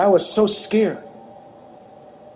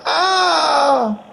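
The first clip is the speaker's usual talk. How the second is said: retold scream; 10 semitones higher than usual. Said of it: "and she screamed".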